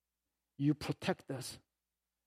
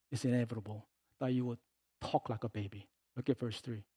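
normal talking voice

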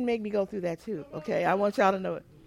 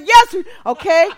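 excited; 5 semitones higher, 15 dB louder than usual. Retold or imitated. Nurse excited about shared song.

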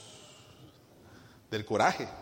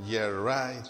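mock low "Yeah, right" in English; sarcastic English aside; 3 semitones lower than usual. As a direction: roll your eyes at the father, in English. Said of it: "en inglés, ¿verdad?". (hijo sarcástico al papá, burlón en inglés)

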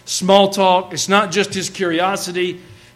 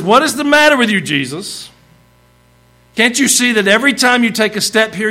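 high-pitched exasperated challenge; impetuous; 5 semitones higher, 4 dB louder than usual. Acted. Disciple to Jesus in a jostling crowd, impetuous and exasperated. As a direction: demand an answer in impatient disbelief. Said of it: "impetuous spirit".

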